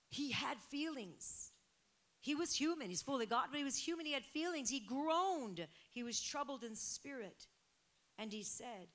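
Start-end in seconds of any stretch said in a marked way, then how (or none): 0.1-7.5 rising, loud, fervent preaching; preaching at full force. Real, not acted. Pastor to church, fervent loud preaching. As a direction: lift the room, louder and higher.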